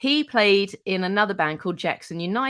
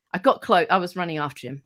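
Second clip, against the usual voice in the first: ordinary talk, nothing marked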